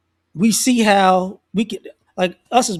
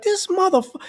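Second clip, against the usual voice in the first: mock cussing out; 10 semitones higher than usual. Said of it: "he went off like".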